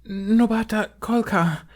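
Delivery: high, slow pleading; beg, frightened and high; begging girl. A narrator's reading. Slave girl begging the Hutt, high and frightened. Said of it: "the girl begged".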